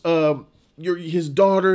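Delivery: ordinary delivery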